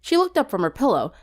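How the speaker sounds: ordinary delivery, nothing marked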